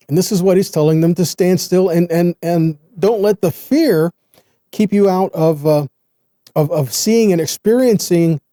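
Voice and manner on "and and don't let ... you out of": usual speaking voice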